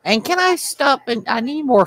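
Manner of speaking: squeaky high-pitched party member; high character voice. Acted. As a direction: pipe up in a high voice.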